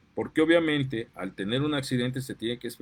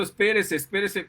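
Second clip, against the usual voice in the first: a raised, urgent call, imitating the people warning her; retold mock shout; 6 semitones higher than usual. Bystanders warning the mother not to cut in front of the truck, mock shout. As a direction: call out to stop her, half-acted. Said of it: "Le están diciendo".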